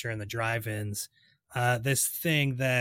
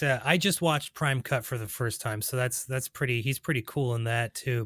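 usual speaking voice

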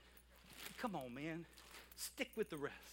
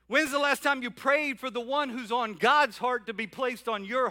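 louder, insistent preaching challenge; pastor's louder rhetorical questions; full-force preaching; 7 dB louder than usual. Acted. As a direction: press every question home.

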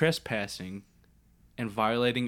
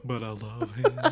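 giggly mock gush; laughing mock believer; slower than usual. Friend joins the joke about a believer, laughing. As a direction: laugh through the words of love.

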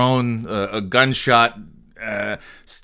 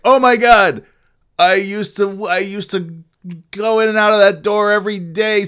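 much higher, gushing, animated; excited reminiscing; 7 semitones higher than usual. Retold or imitated. Recalling walking through that door every day, excited gushing. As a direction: gush like seeing your old haunt on screen. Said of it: "But it's like".

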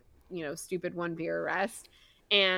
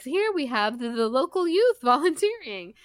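high, louder showy presenter pitch; mock sales pitch to reporters; talking it up; 7 semitones higher, 6 dB louder than usual. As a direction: present it proudly, laughter creeping in. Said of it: "trying to, like, talk it up".

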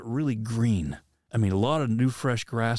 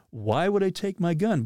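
retold protest, pitched higher; arguing; 7 semitones higher than usual. Hunter arguing with the voice about his gun, mildly protesting. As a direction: argue back in mild disbelief. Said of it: "I'm arguing with this voice".

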